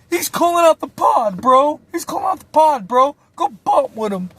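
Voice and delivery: high, loud hyped-up snitching; shrill mock instigator telling on him; high agitated instigator. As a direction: egg him on, voice cranked high. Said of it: "He's like".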